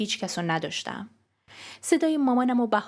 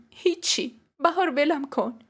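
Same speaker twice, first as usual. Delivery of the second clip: high, crying brush-off; upset, pitched-up plea to be left alone; tearful; 7 semitones higher, slower than usual. Acted. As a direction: push someone away through tears.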